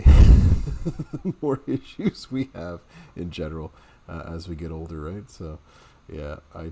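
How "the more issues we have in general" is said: laughing through the words. Real, not acted. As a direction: giggle while talking. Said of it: "laughs through line".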